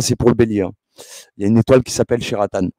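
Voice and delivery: usual speaking voice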